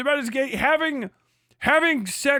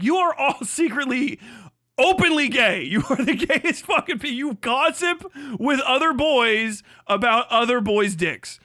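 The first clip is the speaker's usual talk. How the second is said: usual speaking voice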